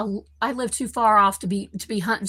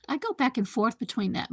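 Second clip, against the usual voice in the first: usual speaking voice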